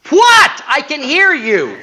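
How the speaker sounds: very high, loud, slow squeak; a tiny sweet voice, stretched out; sweet little voice. Retold or imitated. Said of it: "this sweet little voice comes out".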